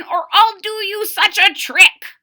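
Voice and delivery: put-on high, loud pleading old-man voice; crying old man. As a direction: beg him to stop like an ancient man.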